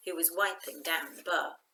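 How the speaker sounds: ordinary delivery, nothing marked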